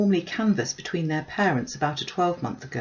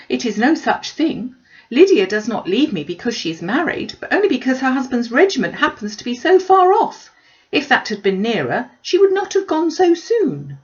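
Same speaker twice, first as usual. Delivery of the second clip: higher, louder protesting mother; mother insisting she's wronged; fretful mother objecting; indignant Mrs Bennet; 6 semitones higher, 7 dB louder than usual. A narrator's reading.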